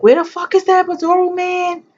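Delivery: very high, loud enraged scream; screaming for the anesthetist; furious scream. Retold or imitated. Woman in labor screaming for the epidural man, furious. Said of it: "I was like".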